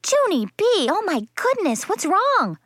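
high-pitched worried mother; alarmed calling out. A narrator's reading. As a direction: call out to your child in alarm. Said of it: "she called".

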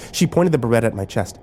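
plain reading voice

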